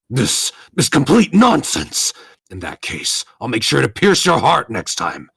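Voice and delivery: loud, high indignant outburst; outraged villain. Acted. Thwarted villain threatening his rival, outraged. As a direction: rage at the unfairness, stretching the words. Villain rages at unfair turn.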